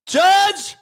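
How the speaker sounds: high, drawn-out mock-panicked wail; desperate pleading shout. Defendant begs judge for jail, desperate comic shout. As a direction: beg at the top of your voice.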